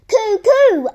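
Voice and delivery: high, loud bird call down the chimney; cuckoo call. Acted. Reggie calling down the chimney as a cuckoo.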